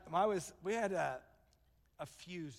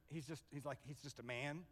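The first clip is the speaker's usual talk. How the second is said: low, much softer aside; retold quiet doubt; 5 semitones lower, 14 dB quieter than usual. Wife to husband about his friend, quietly unimpressed. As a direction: imitate a wife's hushed, dismissive verdict.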